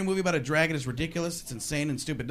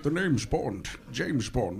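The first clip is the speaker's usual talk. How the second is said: low Scottish Bond line, lower on James Bond; Sean Connery; 7 semitones lower, 3 dB quieter, much slower than usual. Retold or imitated. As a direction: purr it like Connery. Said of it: "He's like".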